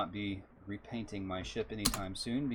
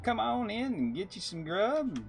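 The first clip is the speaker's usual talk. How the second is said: high, hammed-up Southern hostess voice; Southern welcome; 8 semitones higher than usual. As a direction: chirp it like a folksy Texan lady calling you in. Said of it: "Just be like".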